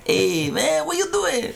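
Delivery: high, playful mock Spanish accent; mock Spanish accent talking to dog. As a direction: sing it up high like chatting to a little Chihuahua. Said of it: "I always talk to him like this".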